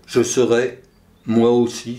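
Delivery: ordinary delivery, nothing marked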